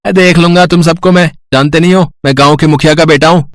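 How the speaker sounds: put-on mildly angry, boastful boy; a touch of threat in a boy's voice; angry boasting. Village chief's son threatening his friends, mildly angry. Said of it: "किशोर गुस्से में".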